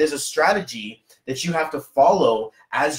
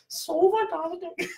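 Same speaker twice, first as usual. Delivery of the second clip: Sally's attempt at "So fantastic" in an Indian accent, much higher, softer and slow; imitating Sally's try; 6 dB quieter, slower than usual. Host imitating Sally copying his Indian accent. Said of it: "Can you do that?" She's like".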